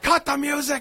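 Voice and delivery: high-pitched mock yell; imitated angry demand. Imitating a wrestler interrupting entrance music, mock angry. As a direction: demand it in a high, hammed-up fury. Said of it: "pissed and angry".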